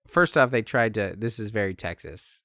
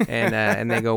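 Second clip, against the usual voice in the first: usual speaking voice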